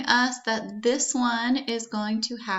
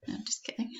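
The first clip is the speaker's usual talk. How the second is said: whispered aside; 11 dB quieter, much faster than usual. Real, not acted. Joke aside after the example sentence.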